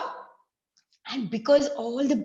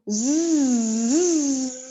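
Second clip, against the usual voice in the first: loud bee buzz; 5 dB louder than usual. Acted. Teacher making the bee's flying buzz for the children. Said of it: "So he flew".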